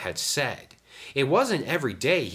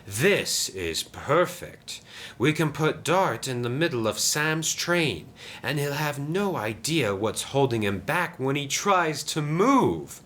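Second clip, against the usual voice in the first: scheming Diesel; 7 semitones higher than usual. Acted. Diesel plots against Sam, gleefully scheming. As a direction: savour the sly plan.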